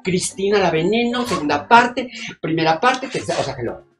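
brash, loud, fast-talking show-off starlet; loud diva. Acted. Vain actress on the phone to her agent, loud diva gush. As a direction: gush and snap like a vain celebrity. Diva character argues in line.

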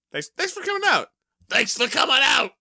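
high, rising mock yell; mock falling yell. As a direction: yell it as you fall. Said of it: "what he yells as he falls".

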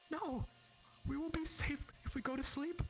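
very high voice, near tears; frightened little girl close to crying; child starting to cry. Acted. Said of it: "says louder, starting to cry".

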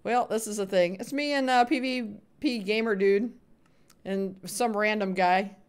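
normal talking voice

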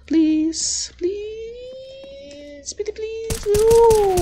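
very high squeaky begging; sky-high sugary please please; cutesy pleading. Shown as a demonstration. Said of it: "I don't speak like that".

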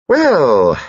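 high, falling attention-getter; loud eccentric dowager. Acted. Dowager breaks in wanting supper, loud. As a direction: swoop down loudly from a high start.

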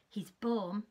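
ordinary delivery

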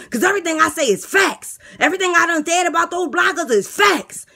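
outraged lisping inmate. Acted. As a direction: fire back at the insult.

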